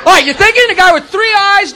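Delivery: normal talking voice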